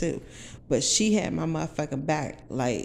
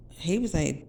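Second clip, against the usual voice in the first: normal talking voice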